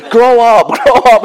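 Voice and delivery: high, loud mock scolding; hammed-up shrieking telling-off; imitated nagging wife. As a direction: call it out as an exasperated wife. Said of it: "my wife has been saying to me".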